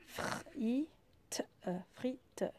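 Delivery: Frite dit doucement, plus aigu; vérifier le mot en le disant doucement; sounding out the word. Shown as a demonstration. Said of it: "est-ce que c'est un O ou est-ce que c'est un I?". (mot frite articulé doucement)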